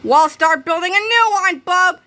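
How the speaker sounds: put-on high, loud bossy kid; a principal in a kid's body barking orders; bossy shout. A narrator's reading. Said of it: "shouted Croppy the Kid".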